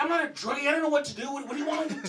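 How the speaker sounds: high, fast frantic voice; wife mocked as frantic; flustered wife. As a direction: panic back at him. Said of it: "She's like".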